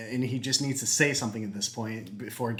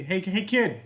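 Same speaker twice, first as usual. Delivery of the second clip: higher voice, rising in pitch and volume; nosy driver calling to a kid; prodding bus driver; 9 semitones higher, 4 dB louder than usual. Acted. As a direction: nudge the kid for gossip, getting higher. Driver prods the silent kid.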